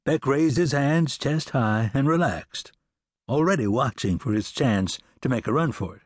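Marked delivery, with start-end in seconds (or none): none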